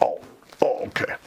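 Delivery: whispered, deflated climb-down; sheepish whisper. Retold or imitated. Commander realizing he's off the hook, whispered and sheepish. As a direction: let the wind out of him.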